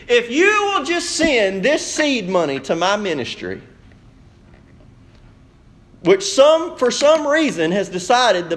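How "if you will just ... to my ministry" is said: mock prosperity preacher. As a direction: plead for seed money like a TV preacher. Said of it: "I've seen prosperity preachers that do this".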